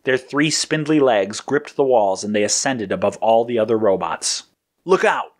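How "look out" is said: higher, contained warning cry; mild storyteller's shout. A narrator's reading. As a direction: call out a quick warning. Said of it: "Blue shouted to Yellow".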